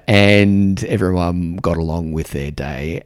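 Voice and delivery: ordinary delivery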